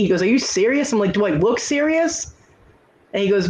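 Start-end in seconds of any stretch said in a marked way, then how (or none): 0.2-0.9 imitating an incredulous customer, pitched high; mock incredulous. Mayor balks at being carded. 1.1-2.3 high, drawn-out mock retort; retold retort. Clerk retorts to balking mayor.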